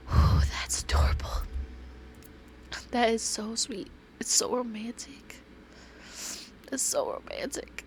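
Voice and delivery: high, soft, whispery swoon; touched swooning. Real, not acted. Player moved by a love letter, whispery swooning. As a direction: melt into a half-whispered coo.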